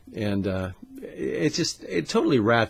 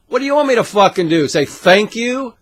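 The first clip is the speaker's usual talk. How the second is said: loud, high outburst; angry retort; 9 semitones higher, 9 dB louder than usual. Real, not acted. Ranting back at commenters, loud and high. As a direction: shout back at the critics.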